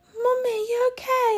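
put-on very high, small child voice; concerned toddler. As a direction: imitate a little boy checking on his mum. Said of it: "his little face, he was like".

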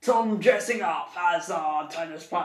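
very slow mock Liverpool voice; Scouse accent. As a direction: play Paul in a put-on Scouse accent. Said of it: "get rid of the accent".

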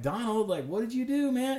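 high, loud, incredulous "what did you do, man"; a teacher catching the damage, voice shooting up; exasperated demand. Retold or imitated. Teacher confronting Donald over the broken monitor, loud disbelief. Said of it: "I'm like".

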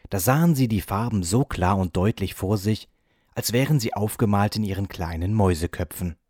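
plain reading voice

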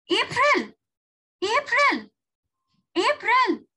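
high, loud repeated cries; screamed name. A narrator's reading. Julia searching for missing April, screaming her name. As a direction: shout out for a missing partner. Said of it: "I screamed".

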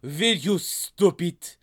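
put-on thick French-accented banana. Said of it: "focused on this accent".

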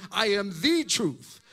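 "the" in "I am the truth" given the weight; stress on THE. Shown as a demonstration. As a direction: hit "the", not "truth". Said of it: "That means he is the source of all truth".

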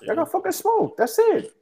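high, gleeful taunt at the losing team. Real, not acted. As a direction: rub it in with a high jeer. Taunting rival team, voice shooting up.

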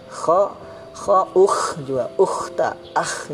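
kho, ukh, akh with dry upper-throat scrape; slightly raspy kh. Shown as a demonstration. Teacher drilling learners, raspy voiceless kh in ukh and akh. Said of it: "agak-agak serak gitu".

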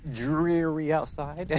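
"dreary" stretched slowly, the R weak, near "dweary"; "dreary outside" said carefully, the R rounded toward W; says w for r. Real, not acted. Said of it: "I can't say my R's very well".

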